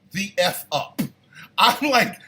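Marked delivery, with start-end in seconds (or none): none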